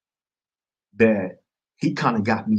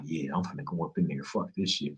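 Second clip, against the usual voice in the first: low muttered resolve; 6 semitones lower, 10 dB quieter than usual. Retold or imitated. Deciding to confront counselor.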